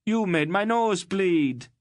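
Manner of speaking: bloodied redhead wailing at Garion. Acted. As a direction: whimper it like a hurt bully. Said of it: "he wailed accusingly".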